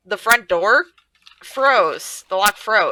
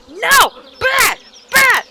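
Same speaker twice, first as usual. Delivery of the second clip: mock scolding; 6 dB louder, much slower than usual. Scolding coworkers like a dog.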